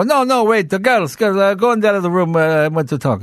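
put-on Russian accent, high, holding the guest back; Boris insisting.

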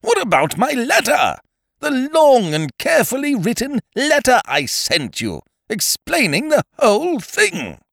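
high-pitched, loud outrage; high, emphatic indignation; indignant outburst. A narrator's reading. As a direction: protest hotly at the top of your register. Ukridge indignant about his letter.

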